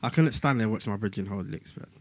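ordinary talk

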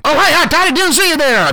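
ordinary talk

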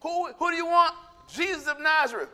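high-pitched acted back-and-forth; acted challenge. Jesus and the crowd facing off, acted challenge.

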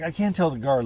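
ordinary talk

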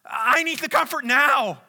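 high, loud cry of a desperate prayer; a sufferer crying out for comfort now, loud and pleading; desperate plea. Retold or imitated. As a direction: plead to God out of the pain, loud. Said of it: "we think".

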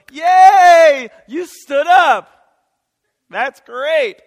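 big loud cheer, slowing on "you stood up"; proud parent shouting praise at a wobbly toddler. Pretend. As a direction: cheer your toddler on at the top of your voice. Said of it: "and you go".

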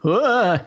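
high, loud startled ah; startled yelp. Acted. Grizz yelps as Petra rattles her saber, startled. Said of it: "and he, like".

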